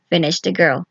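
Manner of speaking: ordinary delivery, nothing marked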